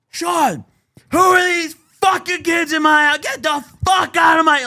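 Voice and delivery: high, loud shrieking mother; a mom screams at her son over the speaker, high and loud; mock screaming mom. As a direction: scream at your son through the intercom. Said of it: "just start screaming".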